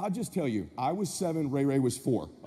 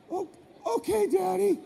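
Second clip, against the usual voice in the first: put-on scared little boy; 10 semitones higher than usual. Scared kid answering his dad.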